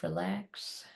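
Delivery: soft spa whisper. Acted. Inner mantra in meditation.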